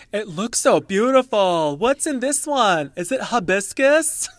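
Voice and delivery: high, slow, loud airy imitation of her voice; drawn-out exaggerated impression of Lisa; imitating Lisa Barlow. Podcaster mimics Lisa Barlow admiring a margarita, high and slow. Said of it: "and she goes".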